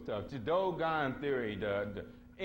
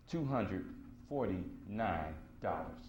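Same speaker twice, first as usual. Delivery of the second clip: low, drawn-out price announcement; slow, deliberate; 6 semitones lower, 4 dB quieter, slower than usual. Real, not acted. Telling audience the book's price, slow and deliberate. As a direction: space out each word slowly.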